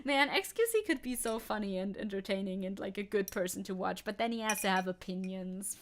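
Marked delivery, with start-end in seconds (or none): none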